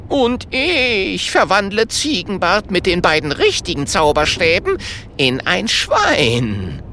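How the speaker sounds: a small wizard relishing his revenge plan; Holunder's eager voice. A narrator's reading. Holunder speaks with shining eyes.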